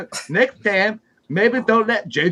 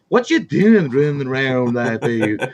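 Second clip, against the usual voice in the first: lower, slow put-on voice for talking to a dog; 6 semitones lower than usual. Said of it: "it's just my dog voice".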